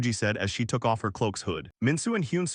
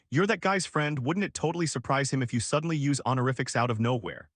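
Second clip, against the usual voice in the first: usual speaking voice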